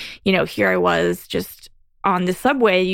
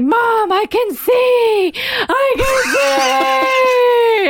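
much higher, louder tearful cry, slowed; crying out to mom; 4 dB louder, slower than usual. Retold or imitated. Calling her mom from the subway, crying out in joy. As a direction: sob it out in overwhelmed joy. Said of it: "And I'm just crying".

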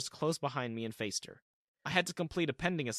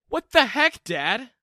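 much higher, much louder near-yell; almost yelled; 10 semitones higher, 11 dB louder, slower than usual. Acted. Son reacting to dad's wedding news, nearly yelling. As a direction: blurt it out in shock. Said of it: "I almost yelled".

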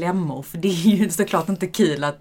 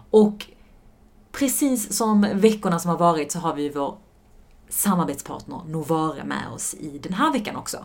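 normal talking voice

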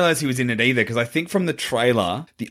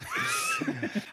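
high shrieking laugh; 12 dB quieter than usual. Real, not acted. Laughing at own bit.

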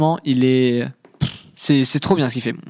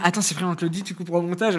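mock aside; 3 semitones higher, 6 dB quieter, faster than usual. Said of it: "j'allais dire".

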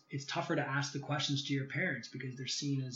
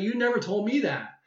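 higher and louder, caught off guard; voicing his stunned friend, pitched up in disbelief; surprised buddy; 7 semitones higher, 7 dB louder than usual. Friend learns a family secret, surprised and raised.

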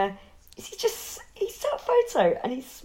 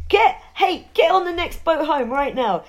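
higher, louder put-on commander; mock officer giving orders; barking military order; 4 semitones higher, 5 dB louder than usual. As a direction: order them home, pitched up. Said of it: "And they're like".

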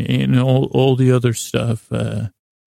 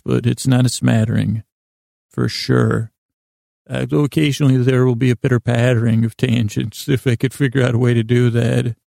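ordinary delivery

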